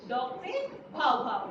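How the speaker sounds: slow barked bow-wow, voice changed; dog barking imitation. Shown as a demonstration. Teacher showing students how a dog barks, slow mock bark. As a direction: bark "भाव भाव" like a dog for the kids. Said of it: "doggy कैसे करता है?".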